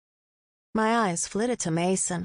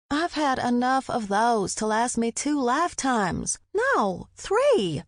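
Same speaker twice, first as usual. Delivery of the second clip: whiny, rising protest, higher on No three; pouty exclaim; 5 semitones higher, slower than usual. A narrator's reading. Heroine protests boyfriend's sludge drink, pouty and high. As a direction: pout and protest the extra drinks. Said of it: "I exclaimed with a pout".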